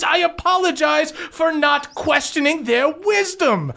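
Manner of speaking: high-pitched, heated bickering; yelling argument. Acted. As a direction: pile on sarcastic complaints in a high voice. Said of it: "yelling over each other".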